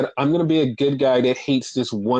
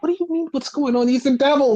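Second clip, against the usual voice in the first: incredulous; 10 semitones higher, faster than usual. Retold or imitated. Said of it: "It's like".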